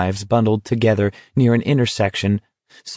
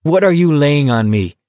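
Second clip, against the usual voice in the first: higher-pitched angry demand; furious challenge; 5 semitones higher, 4 dB louder than usual. A narrator's reading. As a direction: throw it out as an indignant challenge. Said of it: "Collie Parker said furiously".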